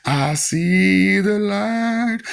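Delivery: slow, high, drawn-out preacher cry; preacher revelation. Acted. Preaching to the listeners, slow high revelation. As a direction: stretch it out like a revival shout.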